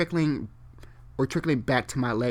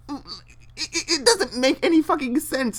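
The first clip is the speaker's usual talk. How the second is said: exasperated high pitch; 12 semitones higher than usual. Real, not acted. Exasperated reaction.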